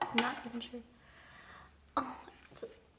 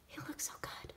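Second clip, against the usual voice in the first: whispering; 10 dB quieter than usual. Real, not acted. Whispering over photos.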